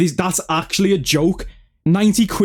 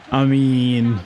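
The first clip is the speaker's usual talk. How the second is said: ordinary talk, nothing marked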